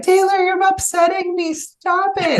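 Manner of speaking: high-pitched imitation of an upset little boy; screaming, crying son. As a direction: mimic a child wailing at a sibling. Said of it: "my son was then screaming, crying".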